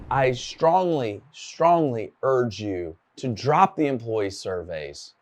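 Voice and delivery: slow, weighted strongly, strongly; slow earnest urging of the audience; emphatic plea. Real, not acted. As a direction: lean hard on each strongly. Closing appeal to the audience.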